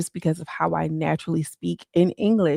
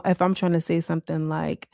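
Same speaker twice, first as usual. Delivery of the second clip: ordinary talk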